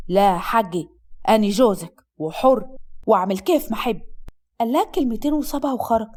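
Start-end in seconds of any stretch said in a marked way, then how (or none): 0.1-4.0 low, self-assured male voice; domineering husband. Acted. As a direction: assert your rights in a deep voice.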